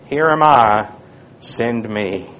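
low, soft and slow, almost breathed; hushed humble answer. Acted. Isaiah answering the Lord in a reverent hush. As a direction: offer yourself in a reverent hush.

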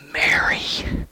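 soft whisper of her name. Acted. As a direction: breathe the name gently, almost silent. Jesus gently calling Mary's name.

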